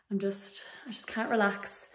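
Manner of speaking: worried whisper. Real, not acted. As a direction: breathe it out nervously. Worried confession before flight.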